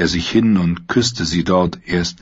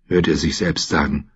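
ordinary delivery

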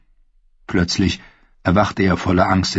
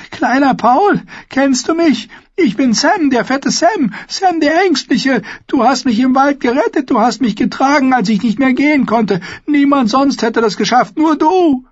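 high, louder, trembling plea; frightened pleading; 4 dB louder than usual. Acted. Sam pleads with the dead Paul, terrified begging. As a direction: beg him to remember you.

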